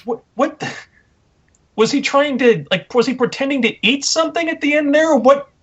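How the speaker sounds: high, incredulous what-was-that; baffled disbelief. Real, not acted. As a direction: sound utterly baffled by what you saw. Baffled rant about the promo.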